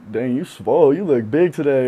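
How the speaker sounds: loud, lifted self-hyping; mirror pep talk. Retold or imitated. Player talking himself up in the mirror, loud hype. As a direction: hype yourself up with some punch.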